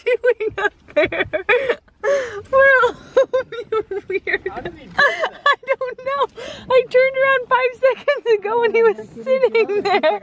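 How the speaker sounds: high amused dog-talk voice; pet talk. Real, not acted. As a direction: coo at the silly dog, amused. Talking to the dog.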